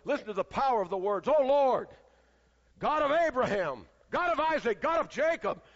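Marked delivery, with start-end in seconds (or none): 1.3-5.6 Oh Lord called out high and loud; loud prayer. Retold or imitated. Elijah crying out to God, loud and high. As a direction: cry it out to heaven. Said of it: "he was not quiet".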